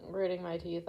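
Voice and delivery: usual speaking voice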